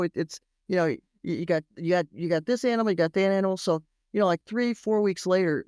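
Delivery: ordinary talk, nothing marked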